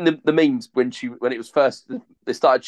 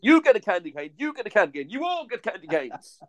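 excited; 8 semitones higher than usual. Pretend. Mock giveaway to everyone.

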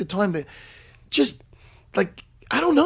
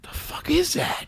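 slow hushed whisper; 5 semitones higher, 9 dB quieter, slower than usual. Real, not acted. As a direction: whisper it in disgust. Whispering about the smell.

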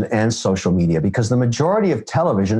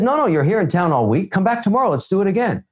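eager producer; 8 semitones higher than usual. Retold or imitated. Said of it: "He said".

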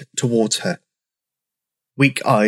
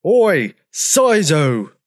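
long, drawn-out angry call; storybook roar, higher and slow; 5 semitones higher, much slower than usual. A narrator's reading. Old Triceratops storming out at Sizo, roaring. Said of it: "he roared".

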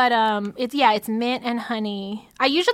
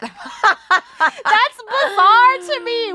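slow, high laugh through the words; laughing in disbelief; 9 semitones higher, much slower than usual. Real, not acted. Amused disbelief at the note.

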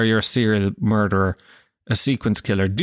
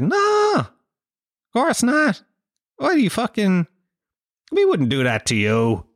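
mock-innocent denial; 10 semitones higher than usual. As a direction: play hammed-up offended innocence to reassure a suspect. FBI falsely reassuring the killer.

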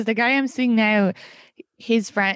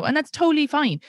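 usual speaking voice

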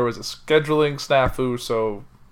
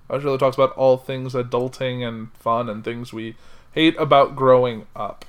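ordinary talk, nothing marked

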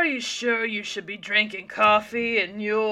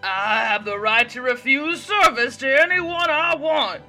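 louder, higher indignant retort; rising voice; 7 semitones higher, 8 dB louder than usual. A narrator's reading. Waitress Diana refuses to serve Mary, voice rising. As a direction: stand your ground and raise your voice. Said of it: "Diana's voice was rising now".

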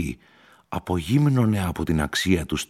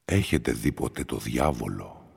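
a hint of a low, uncanny stranger; the stranger quietly questions Heinrich, lightly low and eerie; eerie stranger's question; 5 semitones lower, 4 dB quieter than usual. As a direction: ask it lightly lower, with a quiet chill. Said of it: "σε τόνο τόσο αλόκοτο".

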